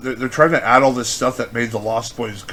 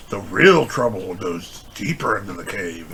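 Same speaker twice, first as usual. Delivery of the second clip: lightly voiced, slow and low mock spooky tone; a hint of a trailer voice teasing a bigger threat; mock ominous sequel pitch; 6 semitones lower, 4 dB quieter, slower than usual. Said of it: "so they come up with".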